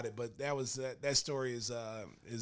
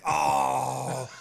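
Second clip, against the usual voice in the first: loud, drawn-out "oh"; retold dismayed groan; 3 semitones lower, 7 dB louder than usual. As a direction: groan at a terrible realisation, played up. Retold dismay at learning who.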